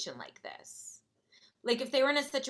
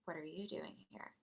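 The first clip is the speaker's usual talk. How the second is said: very soft, lower-pitched question; hushed echo; 4 semitones lower, 17 dB quieter than usual. Retold or imitated. Girl copying the narrator's question, hushed. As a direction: echo the question back, barely audible. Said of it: "she was like".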